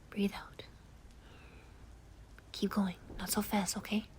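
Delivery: hushed, tender soothing to a friend in tears; calm them down gently, mostly whispered; comforting whisper. Acted.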